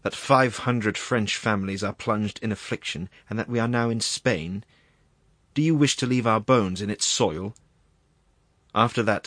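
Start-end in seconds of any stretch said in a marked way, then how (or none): none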